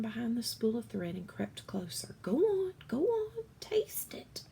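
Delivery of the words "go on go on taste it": coaxing whisper. Acted. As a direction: coax him gently in a whisper. Cook coaxing mouse to taste.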